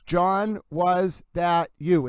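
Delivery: "John, was that you" slowly, word by word; four separate words. Shown as a demonstration. Host counting out four words, slow and separate. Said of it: "It's four words".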